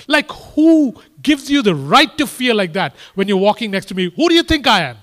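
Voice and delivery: a king scolding his frightened companion; indignant king. Acted. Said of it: "the king looks at you and says".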